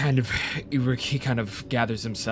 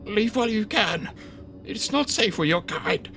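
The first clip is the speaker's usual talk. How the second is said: high, frightened little mouse voice; urgent squeaky warning; groggy little voice; 10 semitones higher than usual. Acted. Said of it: "He whispers in a groggy little voice".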